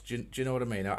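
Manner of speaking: normal talking voice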